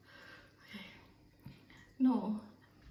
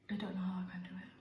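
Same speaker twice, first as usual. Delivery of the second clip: fast whisper; 7 dB quieter, much faster than usual. Real, not acted. Overwhelmed by spice.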